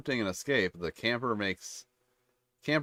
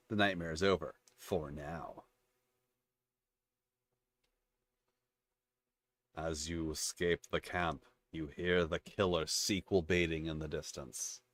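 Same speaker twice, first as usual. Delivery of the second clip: low, soft horror narrator; ominous story narration; 4 semitones lower, 5 dB quieter than usual. Acted. Slasher narrating the sequel-baiting ending, ominous narration. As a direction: lower and hush it like a cliffhanger ending.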